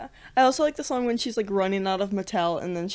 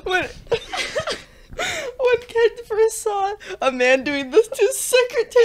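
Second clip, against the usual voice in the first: high, squeaky with laughter; slow, helpless real laughter through the words; laughing while talking; 12 semitones higher, slower than usual. Real, not acted. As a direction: try to tell it while cracking up. Retells scene while cracking up.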